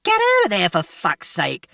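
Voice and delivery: put-on a man screaming at a boy down on the rails; angry yell. A narrator's reading. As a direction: bellow the order, then drag out the swear. Man yells at boy on tracks.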